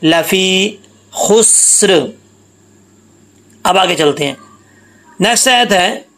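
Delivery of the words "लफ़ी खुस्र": "लफ़ी खुस्र" slowly, long ee and स held into र unbounced; recitation without qalqalah. Shown as a demonstration. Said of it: "तो इसको कैसे पढ़ेंगे". ("लफ़ी खुस्र" बिना कलकला, धीरे खींचकर पढ़ें)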